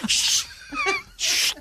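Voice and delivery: sharp hushing whisper; whispered shush. Retold or imitated. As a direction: shush someone in a library. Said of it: "en chuchotant".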